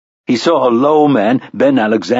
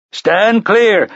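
blaring fire engine; 9 semitones higher than usual. A narrator's reading. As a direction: blare it like a fire engine loudspeaker. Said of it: "blared the fire engine".

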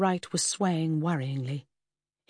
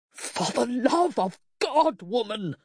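slow, raised-pitch outburst; exasperated shout; 6 semitones higher, slower than usual. A narrator's reading. Husband losing patience with his wife, shouting. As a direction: drag out the oath in exasperation. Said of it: "her husband shouted".

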